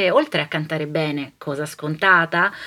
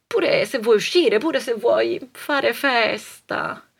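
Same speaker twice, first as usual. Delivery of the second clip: usual speaking voice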